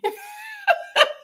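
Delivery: sudden high shriek of laughter; real laugh bursting out high. Real, not acted. Laughing at someone being silly.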